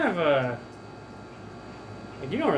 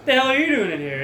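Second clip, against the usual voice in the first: angry guard; 3 semitones higher than usual. Acted. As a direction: bark it at the intruder. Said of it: "He's like".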